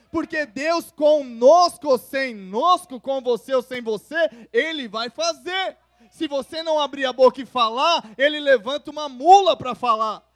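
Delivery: loud, rising sermon climax; fervent pulpit proclamation; full-force preaching. Acted. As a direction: preach it out to the whole church.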